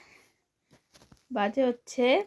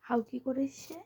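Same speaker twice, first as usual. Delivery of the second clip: speaking softly; 11 dB quieter, slower than usual. Real, not acted. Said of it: "আস্তে আস্তে কথা বলতে হবে".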